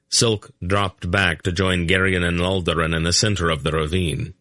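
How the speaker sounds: usual speaking voice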